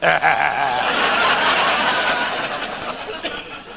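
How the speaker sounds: long unvoiced low chuckle; low wheezing chuckle. Real, not acted. Comic finding a hidden drink, wheezing chuckle.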